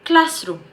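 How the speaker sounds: CLASS in "classroom" pitched high; stress on CLASS. Shown as a demonstration. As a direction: lean on the first part of "classroom", drop the second. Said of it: "stress on class".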